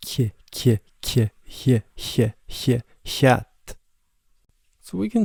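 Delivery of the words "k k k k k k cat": "K, k" turned into a front hiss; softened forward C. Shown as a demonstration. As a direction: let "cat" begin with a light front hiss. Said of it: "it might sound like this".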